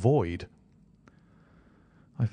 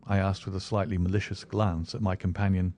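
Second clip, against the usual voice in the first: ordinary delivery, nothing marked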